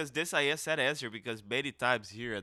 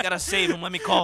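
mock mom; 4 semitones higher, 4 dB louder than usual. Voicing the mother.

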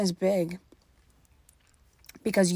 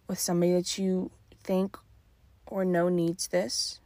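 usual speaking voice

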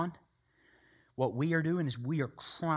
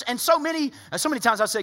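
ordinary talk

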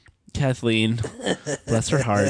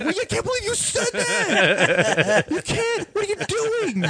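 yelling at the wife in jest, pitched up; mock yell. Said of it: "both Wade and I both yelled at her".

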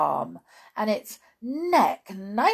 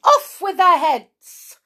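slow, high, furious royal yell; shouting; 12 semitones higher, 14 dB louder, slower than usual. A narrator's reading. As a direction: bellow the order high and loud. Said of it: "she shouted".

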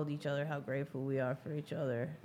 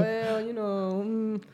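drawn-out, higher, louder mock reply; mock talking back; 5 semitones higher, 6 dB louder, much slower than usual. Imagining talking back to what he's saying, mock comeback. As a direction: act out answering back, slowly and loudly. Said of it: "I could talk back. I'd be like".

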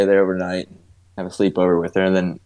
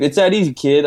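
much higher, louder teasing taunt; mock cocky; 6 semitones higher, 4 dB louder than usual. Uncle taunting the niece back, higher mock smug. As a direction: toss it back smugly. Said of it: "I was like".

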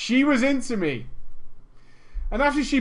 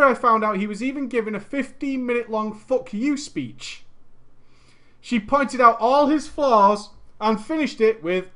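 usual speaking voice

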